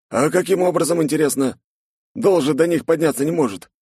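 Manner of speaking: put-on young buddy voice. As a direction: ask like a curious friend. Snegir asking.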